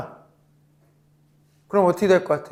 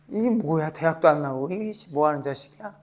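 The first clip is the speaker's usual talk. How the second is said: softer, scornful put-down; contemptuous sneer; 4 semitones lower, 8 dB quieter than usual. Retold or imitated. The world belittling Alexei, soft and scornful. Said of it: "이런다고".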